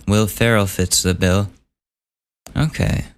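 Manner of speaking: usual speaking voice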